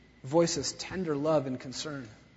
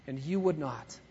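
soft, quieter sorrowful line; sorrowful lament; 4 semitones lower, 6 dB quieter than usual. Acted. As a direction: let it fall in grief. Preacher voicing Jesus' lament.

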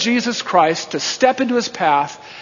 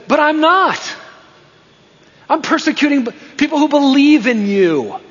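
high-pitched, indignant objection; protesting; 8 semitones higher than usual. Acted. Paul objecting to Jesus's accusation, in high-pitched protest. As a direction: protest your innocence in a raised, higher voice.

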